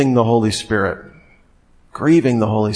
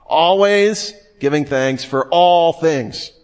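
slow, with "Always" and "all" stretched out and raised; emphatic stress; 4 semitones higher, slower than usual. Shown as a demonstration. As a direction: hammer home Always and all, taking your time. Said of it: "what we would call emphatic language".

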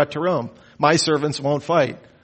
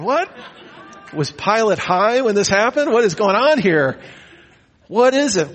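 higher, laughing disbelief; incredulous laughing; 6 semitones higher than usual. Real, not acted.

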